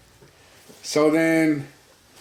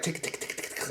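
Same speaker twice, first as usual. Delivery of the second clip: soft whispered tick tick; quiet mouth clicks of texting; imitated phone clicking; 14 dB quieter than usual. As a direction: tap out phone clicks under the breath. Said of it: "like, clicking".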